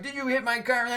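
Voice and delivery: mock outraged driver challenging the cyclist; angry driver. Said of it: "and he goes like".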